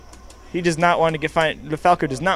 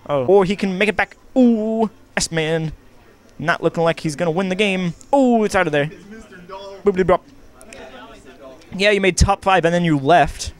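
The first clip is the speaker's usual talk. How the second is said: hyped play-by-play, louder and higher; excited commentary; 4 semitones higher, 3 dB louder than usual. Acted. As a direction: call the action with rising hype.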